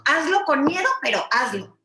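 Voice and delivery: loud rallying slogan. Acted. Emphatic motivational slogan.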